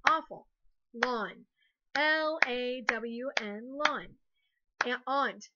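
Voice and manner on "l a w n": slow, letter by letter; spelling aloud. Shown as a demonstration. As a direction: clap out each letter slowly. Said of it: "clap a syllable".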